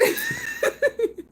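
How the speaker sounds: very high squealing laugh; shrieking laugh. Real, not acted. Streamer cracking up at a viewer's message, squealing laugh.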